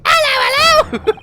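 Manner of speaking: very high, loud caricature British squawk; mock British. Shown as a demonstration. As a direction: squawk a silly British greeting. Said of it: "That's what we all sound like".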